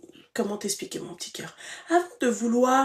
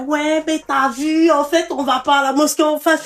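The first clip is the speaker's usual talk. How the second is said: put-on boyfriend making excuses; 5 semitones higher, 6 dB louder than usual. Host mimics the caller's man dodging the mosque, mock excuse-making. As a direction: imitate the man's feeble excuse, loudly. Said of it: "pour trouver une excuse".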